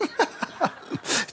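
high breathless laugh; a high, airy burst of laughter. Real, not acted. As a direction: laugh at the story. Laugh after punchline.

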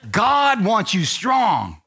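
high, loud preacher's holler, building; fired-up preaching. Real, not acted. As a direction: whoop it up and rouse the congregation. Preacher rousing the congregation.

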